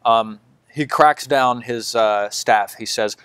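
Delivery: ordinary talk, nothing marked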